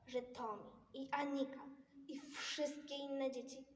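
plain reading voice

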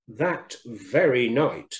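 ordinary delivery, nothing marked

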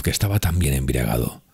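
plain reading voice

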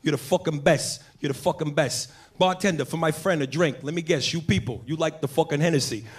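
a friendly Brooklyn wiseguy hosting a guest; Italian-American bar guy. Retold or imitated. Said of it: "He's like".

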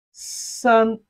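"San" on a high, level pitch; flat tone. Shown as a demonstration. Teacher models Chinese three for learners, flat-tone "San". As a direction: hold "San" steady with no fall. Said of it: "which is the flat tone".

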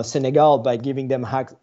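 normal talking voice

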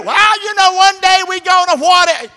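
very high, loud mocking voice; mocking quote. Preacher mocking people who only make plans, shouting. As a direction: squeal out the lazy excuse. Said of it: "mocking planners' excuse".

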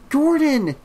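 high, loud searching call; yell. A narrator's reading. Trish searches upstairs for her dog, high loud yell. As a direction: yell the name through the house. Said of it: "she yelled".

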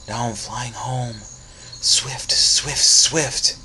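airy, rising whisper of a spirit in flight; ghostly whisper. Acted. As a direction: breathe it out like wind rushing past. Cece's spirit flying home.

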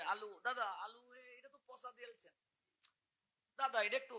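very soft mock grumble to a vendor; distracted haggling. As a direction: complain under your breath between prayers. Mimics chanter haggling between mantras. (জপের ফাঁকে মৃদু স্বরে দরদামের নকল)

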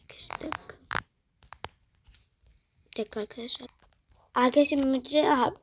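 ordinary delivery

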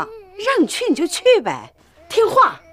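high, impatient motherly command; insistent order. Acted. Mother ordering reluctant children out, insistent.

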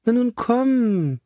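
slow, high, coaxing; conciliatory. A narrator's reading. Mother calming her stunned son, conciliatory. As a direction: soothe him gently. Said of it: "sagte seine Mutter versöhnlich".

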